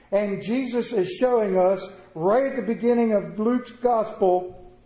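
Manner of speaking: normal talking voice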